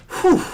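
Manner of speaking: high loud exhale of relief; relieved whew. Real, not acted. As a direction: blow out a big whew. Relief after overtime goal.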